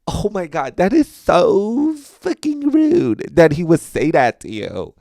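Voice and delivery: high-pitched, drawn-out scandalized voice; mock outrage. Acquaintances offended for the comedian, in mock appalled tones. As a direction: stretch it out, utterly appalled on a friend's behalf.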